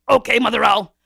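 loud, forceful kingly demand; lion king demanding. A narrator's reading. Lion king questions Mother Owl, booming demand. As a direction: thunder it like an impatient king.

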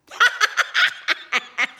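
high, loud cackling rabbit laugh; laughing fit. A narrator's reading. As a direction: burst out in a helpless high cackle. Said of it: "was laughing".

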